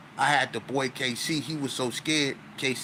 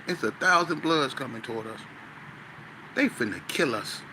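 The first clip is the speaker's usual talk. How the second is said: ordinary talk, nothing marked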